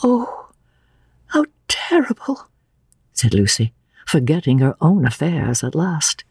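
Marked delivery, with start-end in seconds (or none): 0.0-2.5 very high, appalled reaction; shocked, high feminine voice; horrified young woman. Acted. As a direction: take it in with high-pitched dismay. Lucy hears of the death.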